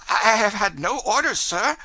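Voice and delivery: high, loud stammering apology; apologetic. A narrator's reading. Frightened cub excusing himself to the pilot, high and stammering. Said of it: "I said apologetically".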